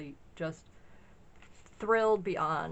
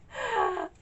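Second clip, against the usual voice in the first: high laugh; 3 dB louder than usual. Real, not acted. Laughing at her own splurge.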